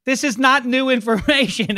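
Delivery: laughing. Real, not acted. Laughs at overhyped schedule news.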